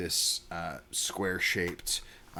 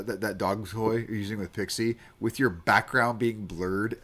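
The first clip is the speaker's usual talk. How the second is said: ordinary delivery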